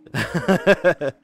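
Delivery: self-mocking laugh. Real, not acted. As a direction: laugh out loud at your own failure. Laughing at own poor English.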